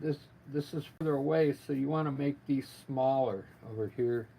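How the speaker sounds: ordinary talk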